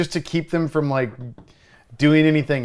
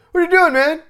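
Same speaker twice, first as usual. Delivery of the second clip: loud, much higher demanding question; exasperated confrontation; 7 dB louder, faster than usual. Retold or imitated. Bartender confronting the drunk who threw a chair, loud and high. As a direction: confront him in disbelief.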